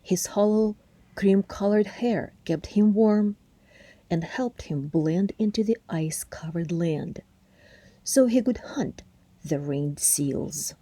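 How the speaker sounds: plain reading voice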